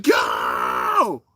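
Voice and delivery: long strained mock yell; drawn-out anime-style yell; imitated battle cry. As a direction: stretch it into a hammed-up launch shout. Imitates blader yelling a launch.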